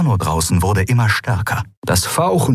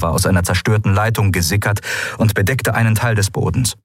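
plain reading voice

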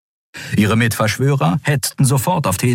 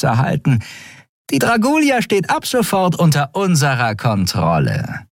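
high purring villainess; 7 semitones higher than usual. Acted. Shiar purrs behind the admiral's back, menacing. Shiar gloats over takeover.

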